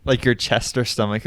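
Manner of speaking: ordinary talk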